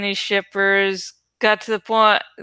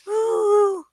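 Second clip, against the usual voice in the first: excited woo; 12 semitones higher than usual. Real, not acted. Cheering before the toast.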